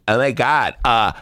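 put-on drawn-out sneering mean girl, very slow; mocking a mean girl's scornful "a loser". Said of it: "like a mean girl in the cafeteria going".

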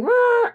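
high-pitched cleaning machine whirr; imitated machine noise. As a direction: imitate an industrial cleaner running. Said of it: "I'd hear the industrial thing".

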